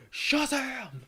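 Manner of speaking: high-pitched hero cry at ordinary volume; imitated hero yell. Fan re-enacting favorite rooftop moment, imitated high yell. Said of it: "he got the yell right".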